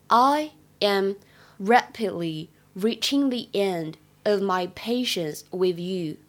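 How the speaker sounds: slow, careful teacher read of "rapidly reaching the end"; slow clear demo of "I am rapidly reaching the end of my patience with you"; slow model reading. Shown as a demonstration. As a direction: spell it out slowly for students. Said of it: "在这句话的朗读过程当中".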